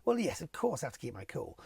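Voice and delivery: ordinary talk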